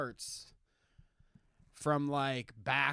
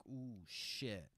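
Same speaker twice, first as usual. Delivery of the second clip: awed murmur; 4 semitones lower, 12 dB quieter than usual. Retold or imitated. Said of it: "it's like".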